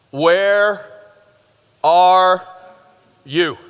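each word spaced out and punched; emphatic question. Shown as a demonstration. Preacher dramatically quoting God's question, each word spaced. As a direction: hammer out each word slowly for the congregation.

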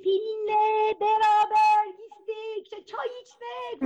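çok yavaş, heceleyerek, yüksek sesle başlayıp sönen; sağır kıza anlatır gibi ağır ağır; yavaş bağırış. Retold or imitated. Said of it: "Nasıl bağırıyor?". (adam sağır sandığı kıza yavaşça bağırıyor)

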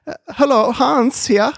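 put-on high, bouncy German accent. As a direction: greet the kids in a cheery German accent. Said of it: "German accent that I do".

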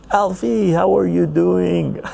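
high, bright hello to a friend; excited greeting. Real, not acted. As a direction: greet him with a big lift. Enthusiastic greeting to chat viewer.

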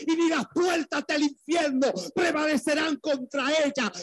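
high ringing preacher's proclamation; preaching at full force. Real, not acted. Preacher proclaiming the church of Christ to the congregation, full force.

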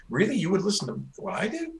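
high, incredulous mock surprise; surprised disbelief. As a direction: act amazed that anyone would listen. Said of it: "you're, like, kinda surprised".

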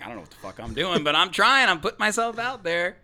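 building to a high, loud plea; mock-desperate protest. Host acting out his early episodes, mock-desperate outburst. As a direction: start brisk, climb into a mock outburst.

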